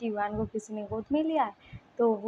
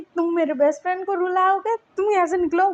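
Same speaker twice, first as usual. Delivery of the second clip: higher, louder angry order to get out; angry telling off; 7 semitones higher, 4 dB louder, faster than usual. Acted. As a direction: throw him out in a temper. Protecting friend, throwing him out. (गुस्से में ऊँची आवाज़ में डाँटना)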